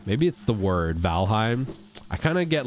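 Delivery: normal talking voice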